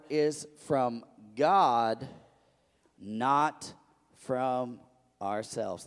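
slow, solemn Bible reading; scripture reading. Acted. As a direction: lay each word out for the congregation. Reads same scripture again.

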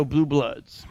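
ordinary talk

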